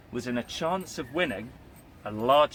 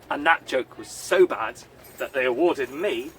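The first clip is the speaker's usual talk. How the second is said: ordinary delivery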